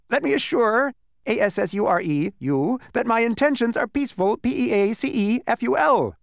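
high, loud bee voice; spelling bee voice. A narrator's reading. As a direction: promise peace, spelling out. Spelling bee reassures Milo.

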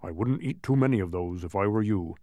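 low advising character voice; Tock. A narrator's reading. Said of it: "advised Tock".

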